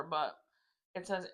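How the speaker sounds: normal talking voice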